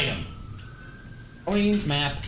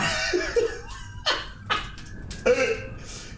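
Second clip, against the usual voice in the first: real burst of high laughter; laughing hard after the imitation; 5 dB quieter than usual. Real, not acted. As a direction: crack up at your own joke. Laughing at own impression.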